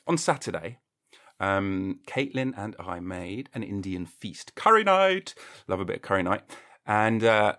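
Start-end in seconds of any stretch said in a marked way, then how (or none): 4.5-5.3 high, loud burst of enthusiasm; excited exclamation. Acted. Announcing the Indian feast to listeners, excited exclamation. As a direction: whoop it out like a cheer.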